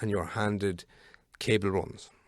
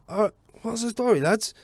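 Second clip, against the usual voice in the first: mock Kilkenny greeting, higher pitched; a broad Kilkenny fitter strolling in; Kilkenny lad; 13 semitones higher, 6 dB louder than usual. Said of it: "he was from Kilkenny or Limerick".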